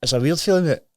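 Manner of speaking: fighting back sudden tears; getting emotional. Real, not acted. Man telling his recovery story to the host, choked up. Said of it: "I didn't think I was gonna get emotional".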